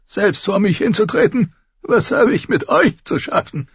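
put-on high, cracked voice of a spiteful old man; cranky old lord. A narrator's reading. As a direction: sneer up high like a grumpy ancient lord. Narrator voices old Lord Walder.

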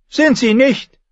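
put-on high, stubborn boy's retort; contradicting child. A narrator's reading. Boy king contradicts his mother, high and stubborn. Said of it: "widersprach Tommen".

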